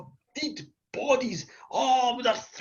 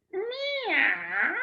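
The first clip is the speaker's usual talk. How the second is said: long high-pitched meow; cat's meow; 11 semitones higher than usual. Acted. Wee cat after tasting blood, long high meow. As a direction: draw out a high kitty meow. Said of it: "it kinda went".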